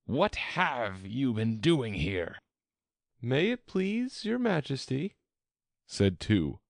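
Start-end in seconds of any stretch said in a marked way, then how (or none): 0.0-2.4 slow, raised royal interrogation; drawn-out imperious queen voice; demanding queen. A narrator's reading. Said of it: "she went on". 3.2-5.2 high-pitched humble address; meek, high subject's voice. A narrator's reading. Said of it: "in a very humble tone".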